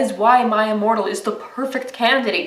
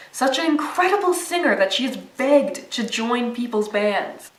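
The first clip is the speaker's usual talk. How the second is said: usual speaking voice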